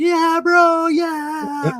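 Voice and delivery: high, drawn-out yell across a valley; whooping long-distance shout; excited holler. Retold or imitated. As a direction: holler it like a stoked bro far away. Hollering back to distant stranger.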